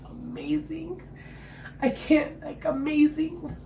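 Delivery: overflowing with wonder at the birth; high, slow delighted gush. Real, not acted. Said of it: "gushing about the delivery".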